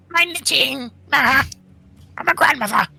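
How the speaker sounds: high, loud comic protest; exaggerated mock-alarmed exclamations; mock outcry. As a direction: wail it in playful indignation. Joking protest about ruining knitting.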